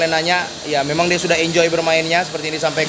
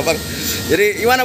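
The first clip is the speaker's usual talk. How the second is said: ordinary talk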